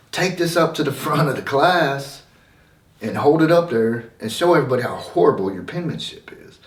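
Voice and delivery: hammed-up harsh teacher ordering; mimicking teacher yelling. Teacher shaming the kid in front of class, mock yelled. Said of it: "she just starts yelling at me".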